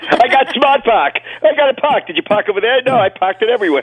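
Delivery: mock Boston accent. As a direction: ham up a Boston parking ad voice.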